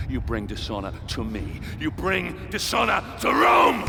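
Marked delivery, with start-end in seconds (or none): 1.8-3.8 much higher, louder, slow furious shout. Acted. General berating the centurion, furious shout. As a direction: roar the last word at him.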